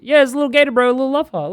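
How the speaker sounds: high, doting little voice; cutesy cooing. Real, not acted. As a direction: coo over it like a pet. Gushing over a cute little toy.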